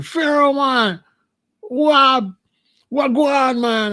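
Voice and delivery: high, loping Jamaican patois hail; Jamaican brethren greeting. Retold or imitated. Brethren greeting him, high Jamaican accent. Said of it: "So I run to the brethren".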